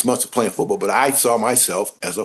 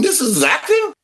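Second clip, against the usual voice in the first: much higher, scoffing question; mock disbelief. Athlete scoffing at his first audition, much higher. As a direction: scoff at how easy it is. Unimpressed by acting.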